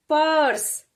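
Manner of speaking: पर्स said in one syllable, the र half-sounded; पर्स with no vowel between र and स; clipped "pers" demo. Shown as a demonstration. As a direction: run र straight into स: पर्स. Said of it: "परस हम नहीं पढ़ेंगे".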